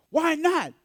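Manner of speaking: high, loud mock protest; client retort. Client challenging William, high loud mock retort. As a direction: fire back like the indignant client.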